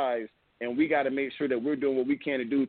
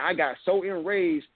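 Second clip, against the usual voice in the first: ordinary talk, nothing marked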